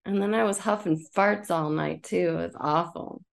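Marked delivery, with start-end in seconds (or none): none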